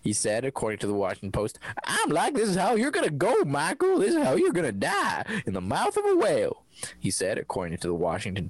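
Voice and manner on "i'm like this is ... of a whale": dramatic fisherman voice. Acted. Dramatized fisherman quote, higher.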